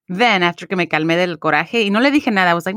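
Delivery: ordinary delivery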